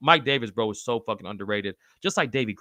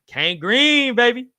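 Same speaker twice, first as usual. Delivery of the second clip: hyped; 11 semitones higher, 7 dB louder, slower than usual. Real, not acted. Host hyping up a nickname a viewer suggested. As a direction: sell the nickname like a hype man.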